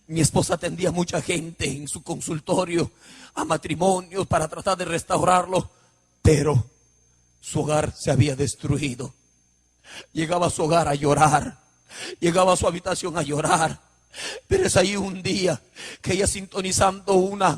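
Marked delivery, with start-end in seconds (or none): none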